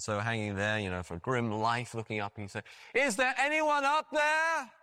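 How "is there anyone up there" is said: high, slow, drawn-out call. Retold or imitated. Stranded man on the cliff looking up, calling out high. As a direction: call upward to someone far above. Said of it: "he called up".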